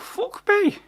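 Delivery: high, startled exclamation; shocked. Acted. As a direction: yelp it in disbelief. Hero shocked the troll talks.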